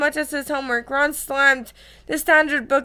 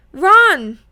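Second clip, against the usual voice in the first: loud voice; 3 semitones higher, 7 dB louder than usual. A narrator's reading. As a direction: call out the name sharply, startlingly loud. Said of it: "when a loud, loud voice".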